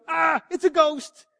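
high, drawn-out yelp of fright; acted panicky cry at seeing something scary; mock frightened cry. Disciples seeing Jesus on the water, frightened cry. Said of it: "They cry out for fear".

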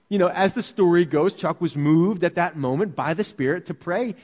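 ordinary talk, nothing marked

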